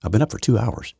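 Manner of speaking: ordinary talk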